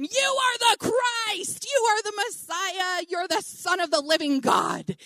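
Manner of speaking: imitating a loudmouth's big announcement; loud-voiced proclamation. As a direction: proclaim it at full voice for all to hear. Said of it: "he's got a loud voice".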